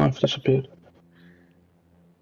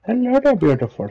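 flirty; 7 semitones higher than usual. Pretend. Greeting another player's avatar.

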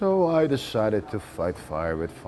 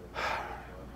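weary sigh; 7 dB quieter than usual. Acted. Tired storyteller pausing after repair man.

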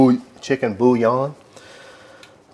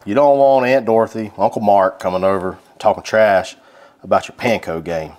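usual speaking voice

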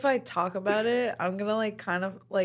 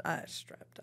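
under-breath whisper; 14 dB quieter than usual. Real, not acted. Muttered self-correction.

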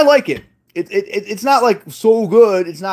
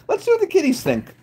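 high, soft little voice; playful high-pitched cooing; cutesy kitty talk; 9 semitones higher, 4 dB quieter than usual. Pretend. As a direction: pitch it up as if cooing about pets. Cutesy take, then repeated normally.

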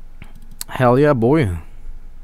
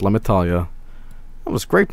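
ordinary delivery

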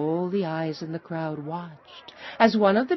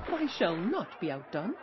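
ordinary delivery, nothing marked

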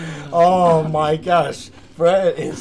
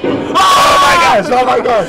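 high, loud, stretched scream; terrified shout; 6 semitones higher, 11 dB louder than usual. Real, not acted. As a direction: scream it out in terror. Monster appears in game.

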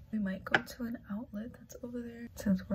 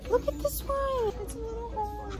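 cutesy squeal; 12 semitones higher than usual. Real, not acted. Cooing over tiny dish.

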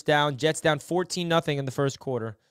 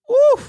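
excited whoop; 12 dB louder than usual. Real, not acted. Celebrating a spin move.

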